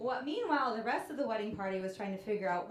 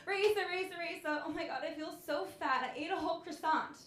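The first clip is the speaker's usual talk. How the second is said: higher, panicky sister voice; sister in a tizzy; 7 semitones higher than usual. Retold or imitated. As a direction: play the frantic sister wailing. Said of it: "she was in a tizzy".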